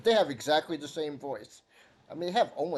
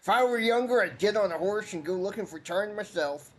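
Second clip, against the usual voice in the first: put-on high old villager; 6 semitones higher than usual.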